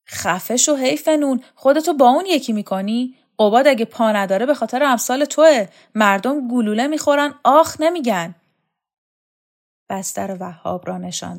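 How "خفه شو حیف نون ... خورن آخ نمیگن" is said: higher, louder angry rebuke; bully shouting down a whiner; angry scolding. Acted. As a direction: tear into him with contempt. Shokat berates Ghadir.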